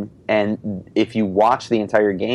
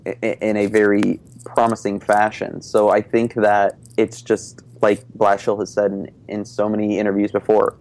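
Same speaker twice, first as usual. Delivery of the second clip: ordinary talk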